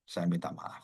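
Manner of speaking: low, soft, halting apology; humble apology. Retold or imitated. Pastor apologises to the offended elder, retold softly and humbly.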